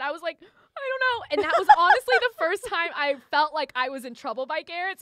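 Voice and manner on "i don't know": high-pitched, whimpering mock cry; tearful retelling. Confessing she is in trouble with her boss, mock tearful. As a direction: squeak it out as if about to cry, playfully. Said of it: "so I'm immediately crying".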